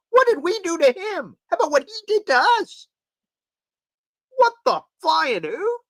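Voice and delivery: high-pitched outraged questions; indignant. Real, not acted. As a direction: yell it at the sky. Indignant outburst.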